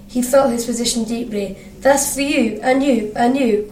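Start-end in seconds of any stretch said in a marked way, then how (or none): none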